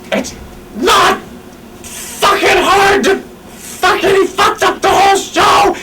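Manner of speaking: high, shouted, half-laughing rant; mock a boss losing it at the crew; imitating a tantrum. Imitating Gabe's backstage tantrum, high mock yell. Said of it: "freaking out and screaming".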